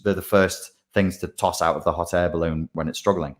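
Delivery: normal talking voice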